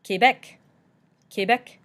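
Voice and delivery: Quebec as kay-BEK, lifted in pitch; French Quebec with an AY vowel, carefully shown; French pronunciation. Shown as a demonstration. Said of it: "in French, it's properly pronounced".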